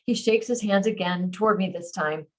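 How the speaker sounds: ordinary delivery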